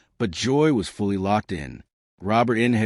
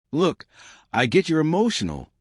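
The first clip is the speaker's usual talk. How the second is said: mildly patronizing, pitched up high; condescending; 7 semitones higher than usual. A narrator's reading. Co-host brushing off a guest's passion, condescendingly. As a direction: talk down to them in a raised pitch. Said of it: "she said condescendingly".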